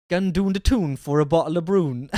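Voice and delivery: practised Geordie catchphrase; Geordie accent. Shown as a demonstration. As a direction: land the Geordie vowels. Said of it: "I'm fucking got it".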